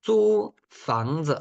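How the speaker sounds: zu fangzi said slowly with Mandarin tones; Mandarin phrase. Shown as a demonstration. Teacher models renting a flat in Mandarin.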